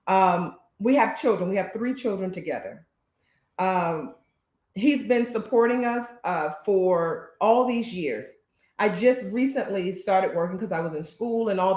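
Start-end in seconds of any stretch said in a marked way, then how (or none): none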